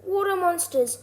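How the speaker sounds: high, loud cry of alarm. A narrator's reading. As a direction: cry out as if spotting monsters. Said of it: "and one cried".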